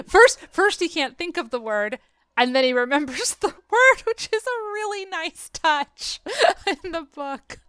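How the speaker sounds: giggling through the words; laughing. Real, not acted. Podcast host laughing about a funny book detail. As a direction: laugh it out while explaining.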